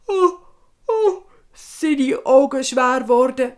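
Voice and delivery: sleepy yawning. A narrator's reading. Boy growing sleepy.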